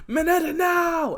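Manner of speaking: high, loud battle cry; shout. Acted. Izuku yelling at Mineta to throw now, loud high shout. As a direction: yell the signal to attack. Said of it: "Izuku would shout".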